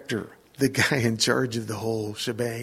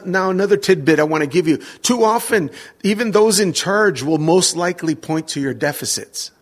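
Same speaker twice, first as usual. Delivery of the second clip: usual speaking voice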